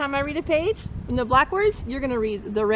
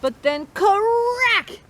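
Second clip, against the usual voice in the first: higher, slow, drawn-out "crack!"; excited crack; 8 semitones higher, 4 dB louder, much slower than usual. Acted. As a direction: stretch out "crack" like the bat hitting. Story climax, crack of bat.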